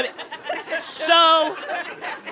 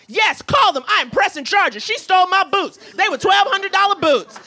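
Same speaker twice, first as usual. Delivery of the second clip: louder, higher, outraged demand; indignant; 7 semitones higher, 3 dB louder than usual. Retold or imitated. Passenger demanding the flight attendant call police, loud and outraged. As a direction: insist on pressing charges. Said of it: "the man was like".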